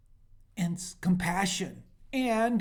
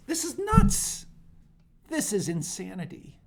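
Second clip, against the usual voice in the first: exasperated; 4 semitones higher, 5 dB louder than usual. Real, not acted. Outraged at EU funding both sides.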